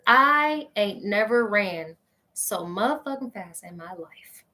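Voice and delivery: ordinary delivery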